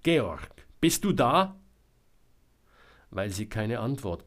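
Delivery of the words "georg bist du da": mutig gerufene Frage, am Ende hoch; louder, braver call into the woods; lauter und mutiger. A narrator's reading. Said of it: "Nun sagte sie lauter und mutiger". (lauter, mutig fragend rufen)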